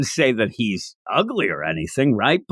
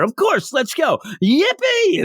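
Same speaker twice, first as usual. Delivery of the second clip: forced cheer; 12 semitones higher than usual. Retold or imitated. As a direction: cheer along because everyone else is. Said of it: "so she has to cheer".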